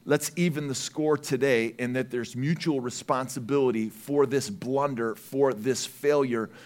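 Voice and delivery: quiet weighty summing up, slowing; solemn slowdown. Acted. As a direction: let each word land heavy. Solemn closing point.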